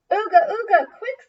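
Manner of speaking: high, loud caveman cry; excited caveman call. Acted. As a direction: hurry everyone aboard. Grunts rush onto George.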